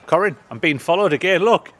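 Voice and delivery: loud, high excited call. Real, not acted. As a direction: call out to someone nearby. Calls out over squirrel.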